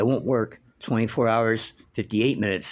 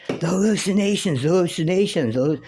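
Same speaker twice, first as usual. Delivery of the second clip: higher, repeated moaning as the sick mother; imitating delirious mother; 9 semitones higher, 4 dB louder than usual.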